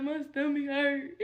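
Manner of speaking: slow, whiny fake sob of a stomach ache; faked crying. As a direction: put on a pitiful crying voice to get sympathy. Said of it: "my stomach did not hurt".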